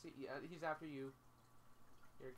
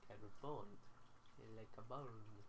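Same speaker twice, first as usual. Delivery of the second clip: lower, playful mock-French accent; put-on French accent; 3 semitones lower, 4 dB quieter, much slower than usual. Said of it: "I was doing a French accent".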